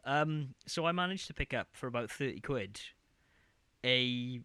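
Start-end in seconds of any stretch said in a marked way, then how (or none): none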